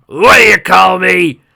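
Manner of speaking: loud slow outraged roar; outraged dwarf roar. Acted. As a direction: roar back at the elf.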